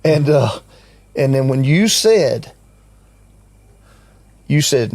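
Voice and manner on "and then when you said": normal talking voice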